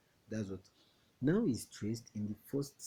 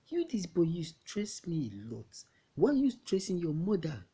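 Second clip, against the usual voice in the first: high, loud exasperated scolding; 8 semitones higher, 5 dB louder than usual. Acted. Adult scolds a boy for stressing his mother, exasperated scolding. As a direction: tell off a naughty boy, raised voice.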